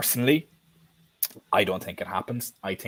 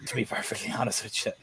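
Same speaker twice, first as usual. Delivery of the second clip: whispered aside; 8 dB quieter than usual. Real, not acted. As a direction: whisper it like a secret. Confiding aside.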